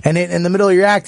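ordinary delivery

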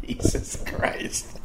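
slow whispered Jesus Christ; whispered exasperation. Real, not acted. Comic exasperated at paying for beach chairs, whispered. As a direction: breathe it out in disbelief. Exasperated aside after laughing.

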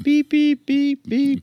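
high beeping like a censor bleep; squeaky mock bleep beeps; honking car horn. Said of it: "We're gonna have to edit that out".

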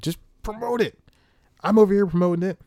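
high-pitched exasperated outburst; exasperated, mad. Real, not acted. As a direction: voice jumps up in indignant frustration. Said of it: "it makes me so mad".

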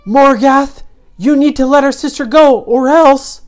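high, loud yell; defiant hero yelling out; yelled threat. A narrator's reading. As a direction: shout an ultimatum at the villain. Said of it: "Ryan yelled out and he said".

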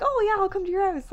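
high, bright-voiced imitation of the therapist; cheery therapist. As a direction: chirp it like the friendly masseuse.

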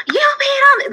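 very high, bright cheering praise; excited praise. Pretend. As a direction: cheer like a delighted parent. Praising child on potty.

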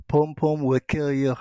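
put-on slow Jamaican accent, wife's voice. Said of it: "in her Jamaican accent".

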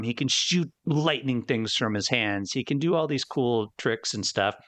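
ordinary delivery

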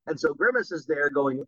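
ordinary talk, nothing marked

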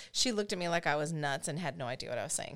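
normal talking voice

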